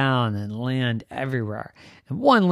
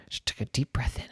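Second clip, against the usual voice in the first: softer, breathy narration; breath taken in, whispered; 5 semitones lower, 9 dB quieter, faster than usual. Acted. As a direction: hush it like you're holding air. Storyteller acts the breath.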